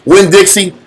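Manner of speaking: ordinary talk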